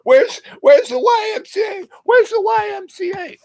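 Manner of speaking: very high shouted plea; high frantic yelling; screaming. Retold or imitated. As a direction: scream it up at the street. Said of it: "the guy's half in screaming".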